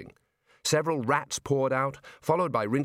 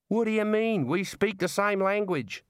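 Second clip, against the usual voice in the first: put-on Australian girl voice; 8 semitones higher than usual. Neelet's lines in the story.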